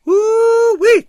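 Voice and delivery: very high, loud "woo-wee" whoop; long ringing whoop of delight; whooping holler. A narrator's reading. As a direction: holler it across the room. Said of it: "came a voice from across the room".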